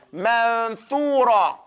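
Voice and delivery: Manthura said very slowly, the th clear; slow drawn-out demo. Shown as a demonstration. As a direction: stretch it out for students.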